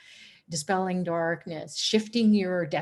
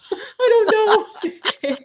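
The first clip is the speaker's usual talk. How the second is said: high, slow, laughing squeak; retelling her blubbering answer to the guru, laughing; laughing helplessly; 14 semitones higher, 8 dB louder, much slower than usual. Retold or imitated. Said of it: "I'll say".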